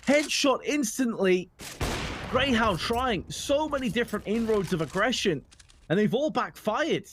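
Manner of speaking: excited high-pitched esports call; rising hype as the kill lands; play-by-play. Acted.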